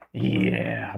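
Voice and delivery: low, soft menacing Sith growl. Retold or imitated. Streamer imitates Sidious turning Sith on Anakin, low soft menace. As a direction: drop into a dark Sith hiss. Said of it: "more like this".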